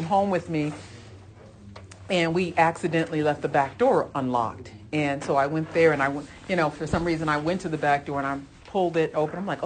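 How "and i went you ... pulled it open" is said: ordinary talk, nothing marked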